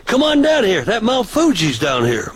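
high call down the sidewalk; retold call to the guys down the sidewalk. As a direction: holler to the fellas down the street. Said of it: "So I yelled down the sidewalk".

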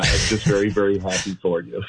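happy, laughing through the words; smiling voice. Real, not acted. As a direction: smile you can hear. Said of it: "You can hear the smile in my voice".

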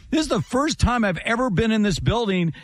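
high-pitched, climbing excitement; amazed retelling. Retold or imitated. Retelling TV partner's amazement at the home crowd, excited and high. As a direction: quote a friend marvelling, pitch rising. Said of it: "he was laughing".

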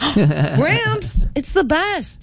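high-pitched exclamation to Gramps; playful protest. Real, not acted. Playful protest at texted opinion.

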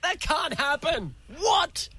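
high, slow disbelief; soft squeaky astonishment; excited. Shown as a demonstration. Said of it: "I do another one".

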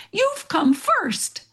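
high, slow, each word spelled out; a friend stressing you came first; emphatic news. Retold or imitated. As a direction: land the big news slowly. Friend announcing her top result.

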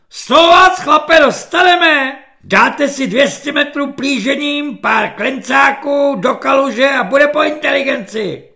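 high, loud barking drill corporal; screeching corporal. Acted. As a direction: yell it at the recruit like a shrill petty corporal. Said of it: "ječivým hlasem svobodníka".

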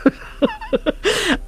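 very high-pitched giggling laugh; real laughter. Real, not acted. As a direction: burst into high giggles at the memory. Se ríe recordando a la monja levantada. (risa real muy aguda)

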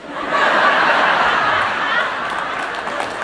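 high breathless laughter; long laugh. Real, not acted. Pastor laughing at his own punchline, high laughter. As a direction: let the laugh take over.

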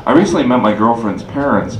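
normal talking voice

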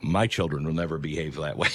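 low, mildly self-assured aside; smug would-be parent. Acted. Person before having children, mildly smug. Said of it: "you're like".

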